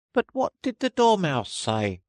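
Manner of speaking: high, curious juror; a small high voice from the jury. Acted. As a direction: pipe up from the jury box. A juror asks.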